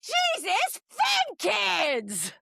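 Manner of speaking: high, drawn-out heckling shout; slow mock yell, each word stretched; retold yell. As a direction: re-enact hollering it across the chamber. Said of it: "So I yell".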